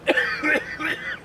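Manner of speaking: rough coughing fit; coughing from gas; harsh choking cough. Real, not acted. Just walked through pepper gas.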